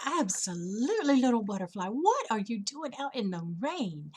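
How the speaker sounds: loud, swooping, emphatic storybook welcome; welcoming flower. Acted. Hibiscus to a butterfly in the rain, emphatic welcome. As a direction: greet the butterfly with big emphatic concern.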